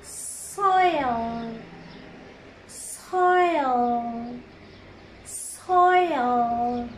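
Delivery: Soil with a long hissed s, drawn out to the l; stretched word. Shown as a demonstration. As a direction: draw out every sound of Soil without breaking it. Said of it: "أوي".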